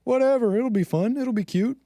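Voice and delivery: put-on high, breezy valley-girl voice; airy mocking impression of a carefree girl; mocking dismissive girl. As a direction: toss it off like it's nothing. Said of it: "And I'm like".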